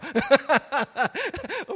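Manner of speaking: loud high burst of laughter; hearty laugh. Real, not acted. Laughs at big promise. (高声大笑)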